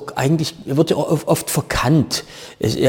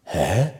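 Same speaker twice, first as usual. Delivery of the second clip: a low "Hä" lifting slightly at the end; low questioning hä; 6 semitones lower, 4 dB quieter than usual. Shown as a demonstration. Saxon comic varies one word for the audience, low questioning hä. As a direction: keep "Hä" low, then nudge it up into a question. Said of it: "kannst aber sagen".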